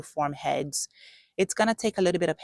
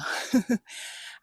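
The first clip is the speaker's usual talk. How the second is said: breathy laugh; 8 semitones higher than usual. Real, not acted. Laughs at being winded.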